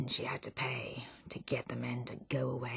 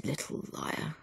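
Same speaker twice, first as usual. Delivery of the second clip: dismissive mutter; 9 dB quieter than usual. Acted. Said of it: "they only answered".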